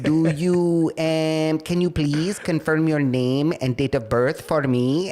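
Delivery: imitated Filipino-accented phone agent; careful questions in a Manila accent; Manila call-center accent. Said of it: "give me a call center in Manila".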